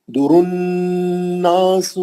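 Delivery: slow الناس with held nasal نون; nasal recitation. Shown as a demonstration. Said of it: "نون پہ غنہ ہے".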